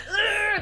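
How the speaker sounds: loud, high strained "ugh" while pushing; straining yell. Retold or imitated. Mom in labor reenacts her yell at the nurses, loud strained groan. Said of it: "like, yelling".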